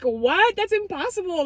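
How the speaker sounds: high, stunned disbelief. Retold or imitated. Interns reading the group chat, high and stunned. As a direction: gasp out that it can't be.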